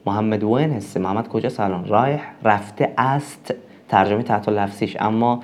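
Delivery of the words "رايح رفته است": ordinary talk, nothing marked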